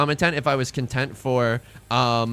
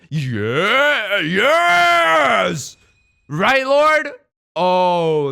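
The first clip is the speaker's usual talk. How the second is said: high, loud triumphant yell; excited shout; 7 semitones higher, 8 dB louder, much slower than usual. Real, not acted. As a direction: cheer the win out loud. Big win lands.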